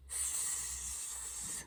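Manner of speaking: soft long hiss; snake hiss. Pretend. Librarian repeating the snake hiss, hissing. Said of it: "a snake is what I found".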